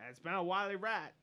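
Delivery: loud, high-pitched mock English accent; bad English accent. Quoting an official on the rat hunt, bad English accent. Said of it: "English people sound like".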